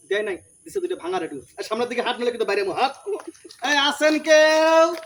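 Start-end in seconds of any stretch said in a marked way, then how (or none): 3.7-4.9 long drawn-out street vendor's cry; hawker's call. Acted. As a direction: call out for customers, stretching the last word. Hawking for customers in the street. (ফেরিওয়ালার মতো টেনে টেনে হাঁক)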